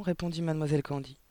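ordinary delivery, nothing marked

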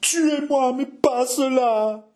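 high wail, slowing at the end; heart-rending cry. Acted. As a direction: drag out the plea in despair. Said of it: "dans un cri déchirant".

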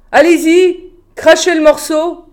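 loud, high, furious demand; angry roar. A narrator's reading. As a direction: roar at them to confess. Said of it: "rugit-il".

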